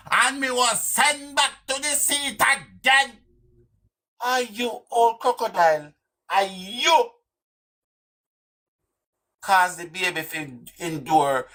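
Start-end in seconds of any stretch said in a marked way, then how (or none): 0.1-3.1 slow, very high-pitched mocking imitation; mock aggressiveness. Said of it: "You hear the aggressiveness?". 4.2-7.1 high-pitched jeering question; mock scolding. Taunting the woman as crocodile.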